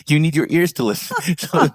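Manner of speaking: said through real laughter, pitched high; chuckling at his younger self's logic; laughing. Real, not acted. CEO laughing to interviewer about his boss, high and amused.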